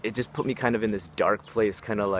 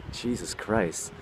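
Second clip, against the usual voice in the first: ordinary talk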